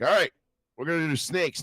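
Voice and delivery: coach calling out. Retold or imitated. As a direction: bark the next drill at the team. Coach announcing drill.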